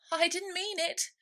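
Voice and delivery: pleading. A narrator's reading. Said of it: "pleaded poor Alice".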